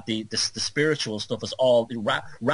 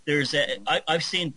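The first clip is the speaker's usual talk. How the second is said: normal talking voice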